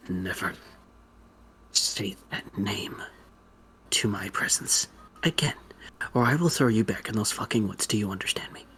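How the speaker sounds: low, hissed threat with a knife out; menacing whisper. Acted. Woman with a dagger to his neck, menacing whisper. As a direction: threaten him in a near-whisper. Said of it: "her eyes kinda widen".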